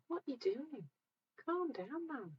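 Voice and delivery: gently mock a raging driver; bemused calm. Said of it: "and you'll be just like".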